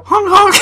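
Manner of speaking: squeaky honking noise; high clown honk. Retold or imitated. Mock horn, high.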